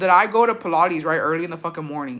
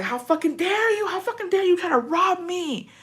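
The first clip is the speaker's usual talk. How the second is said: high, indignant mock fury; imitating her road-rage self; 12 semitones higher than usual. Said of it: "'Cause I have road rage".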